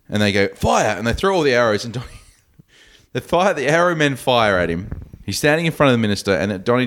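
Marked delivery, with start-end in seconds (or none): 0.5-1.0 a retold battlefield command, pitched up; mock shouted order. Podcaster retelling the film, the officer ordering archers to fire: a mock commanding shout. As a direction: bark it high like a commander. Guards ordered to shoot Donnie.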